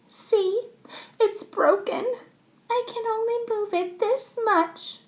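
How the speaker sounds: milk the injury; whiny drama queen. Acted. Chloe shows her finger to the teacher, whiny.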